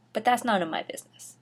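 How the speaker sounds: usual speaking voice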